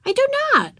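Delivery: put-on indignant, very high little-girl retort; contradicting. A narrator's reading. Ramona argues with her sister Beezus, high contradicting child voice. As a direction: flatly deny it in a high child voice. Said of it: "contradicted Ramona".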